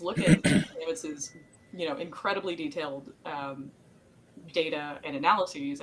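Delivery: ordinary talk, nothing marked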